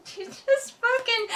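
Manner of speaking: high-pitched, laughing through the words; can barely get it out for laughing. Real, not acted. Cracks up retelling the scene.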